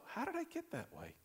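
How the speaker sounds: high, soft, wondering voice; troubled self-questioning. Acted. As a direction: ask yourself in quiet dismay. Acted troubled question to oneself.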